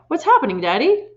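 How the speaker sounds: put-on high, worried child's plea; anxious cry. A narrator's reading. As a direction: ask her father in alarm. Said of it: "she cried".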